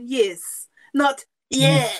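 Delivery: "'Yes,' not 'Yeah.'" said very slowly, stressing "Yes" against "Yeah"; very slow correction of "Yeah" to "Yes". Shown as a demonstration. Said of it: "if you're doing the accent".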